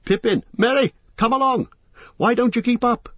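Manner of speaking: loud high calls to the others; loud, impatient calling. Acted. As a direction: shout to the missing friends to hurry up. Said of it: "he called".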